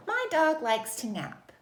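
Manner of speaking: expressive telling sentence, voice down on "nap"; telling statement. Shown as a demonstration. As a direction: read "My dog likes to nap" brightly, dropping at "nap". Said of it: "It's just a period".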